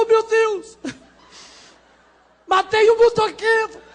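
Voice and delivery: high frantic panicked cries; desesperado. Acted. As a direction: panic like a trapped bird begging. Passarinho desesperado falando. (passarinho desesperado gritando)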